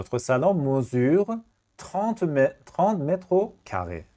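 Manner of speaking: each word drawn out slowly; slow dictation. Shown as a demonstration. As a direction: dictate trente mètres carrés slowly.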